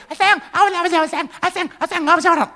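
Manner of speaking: put-on cooing girlfriend. Girlfriend's sweet talk mimicked. (suara pacar manja dibuat-buat)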